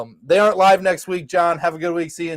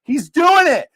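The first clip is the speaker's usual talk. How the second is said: retold excited shout; 10 semitones higher, 4 dB louder, slower than usual. Cheering during arm wrestle.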